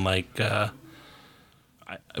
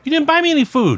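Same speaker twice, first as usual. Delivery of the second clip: very high, loud shout back; loud, high-pitched bickering across the office; real yelled protest; 6 dB louder than usual. Real, not acted. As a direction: yell an objection to someone in another room. Yelled argument across rooms.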